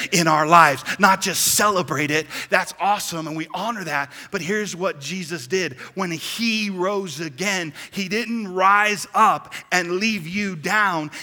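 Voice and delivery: loud, emphatic preacher voice; full-force preaching. Real, not acted. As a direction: drive it home, stretching the key words.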